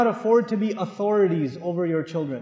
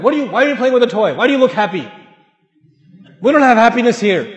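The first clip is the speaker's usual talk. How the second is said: louder, fast, scolding rant; mock angry father; 5 dB louder, faster than usual. Angry father acted out scolding the child over a toy, louder. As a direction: scold the child like a grumpy dad. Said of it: "he's like".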